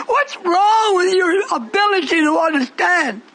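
high-pitched, impassioned pulpit cry; full-force preaching. Real, not acted. Preacher confronting the congregation about their understanding, impassioned shout. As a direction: preach it high and hard to the crowd.

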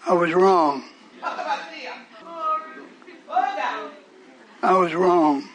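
a hint of a sorry brother. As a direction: imitate him owning it lightly. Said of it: "his answer was".

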